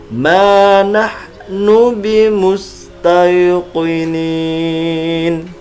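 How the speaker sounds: slow, careful Quran recitation; over enunciating. Shown as a demonstration. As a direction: chant each syllable deliberately. Teacher repeats the verse slowly.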